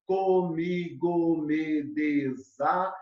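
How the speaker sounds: very slow, syllable-by-syllable verse; counting syllables. Shown as a demonstration. Teacher scanning a verse on fingers, measured syllable counting. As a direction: stretch out each syllable to count it. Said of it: "acompanha aqui no dedinho".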